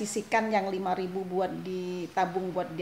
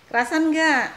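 much louder, high insistent question; loud repeated question; 8 semitones higher, 10 dB louder than usual. Real, not acted. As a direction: call it out to get an answer. Repeats question, friend hardly answered.

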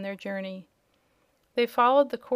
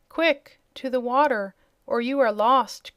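urgent cry; 2 semitones higher, 6 dB louder than usual. A narrator's reading. Unseen friend warning travelers of bears, loud urgent cry. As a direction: call out the danger, loud. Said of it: "cried their unseen friend".

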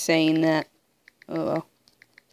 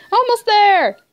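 excited cheer; 9 semitones higher, 5 dB louder than usual. Real, not acted. Excited as goal nears.

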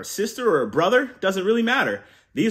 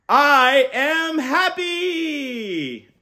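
very slow, loud and much higher; rousing cheer to repeat; 10 semitones higher, 4 dB louder, much slower than usual. Shown as a demonstration. Uncle leading kids in a cheer, loud and slow. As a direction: stretch the cheer so kids join. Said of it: "On the count of three".

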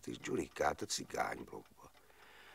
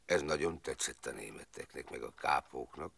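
usual speaking voice

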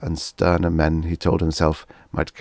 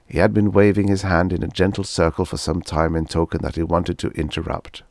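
ordinary delivery

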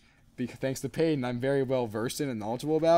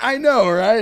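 higher, louder, excited agreeing; eager agreement; 7 semitones higher, 11 dB louder, slower than usual. Retold or imitated. Stranger agreeing about the driver, excited and loud. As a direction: agree loudly and brightly. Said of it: "He's like".

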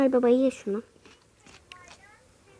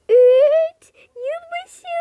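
high, loud cheer on the count; countdown cheer; 13 semitones higher, 7 dB louder, much slower than usual. Acted. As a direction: shout the new year in. Yılbaşı geri sayımı. (yılbaşı sevinç çığlığı)

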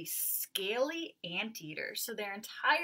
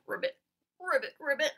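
frog croak; 7 semitones higher, 6 dB louder than usual. Shown as a demonstration. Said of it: "Can everyone hop like a frog?".

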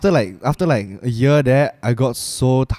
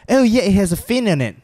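put-on Kiwi accent; 9 semitones higher than usual. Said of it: "the way they pronounce their E's".

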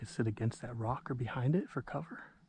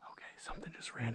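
whispering; 12 dB quieter than usual. Real, not acted. Spotting game while hunting.

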